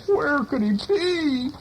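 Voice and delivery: high, slow, searching call; worried boy calling. A narrator's reading. Boy searching for his missing dog, high slow call. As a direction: call out and wonder where he went. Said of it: "Orvy calling and calling".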